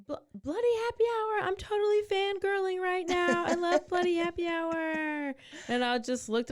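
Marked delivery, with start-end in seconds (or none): 0.1-5.3 very high-pitched fangirl squeal; retold fan yell. Fan yelling from her car window, high mock yell. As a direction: imitate a gushing fan calling out. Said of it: "yells out the window".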